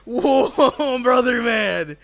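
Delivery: loud, laughing mock praise; laughing exclamation. Streamer mocking a sandwich thrower, loud and laughing. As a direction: blurt it out through a laugh. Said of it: "I'm like".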